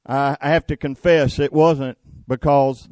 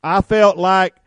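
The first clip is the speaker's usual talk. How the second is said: louder confession, pitch up; fervent preaching; 5 semitones higher, 6 dB louder than usual. Real, not acted. Preacher confesses to congregation, loud and fervent. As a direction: drive the confession home.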